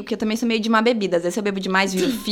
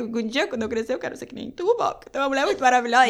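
retold teary toast; 2 semitones higher, faster than usual. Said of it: "Aí eu comecei a chorar".

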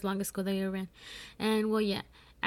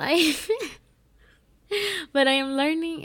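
normal talking voice

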